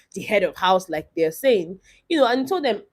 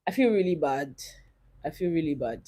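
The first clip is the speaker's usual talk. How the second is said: softer and softer, holding back real tears; close to tears; 5 semitones lower, 10 dB quieter than usual. Real, not acted. Vlogger telling her viewers she feels bad, near tears. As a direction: say it as your voice starts to go. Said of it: "tell me why I'm about to cry".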